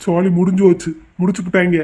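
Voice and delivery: low-pitched voice of defeat; doomed lament. Acted. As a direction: groan that it is all over. Groom bemoans that he is finished. (விரக்தியான புலம்பல்)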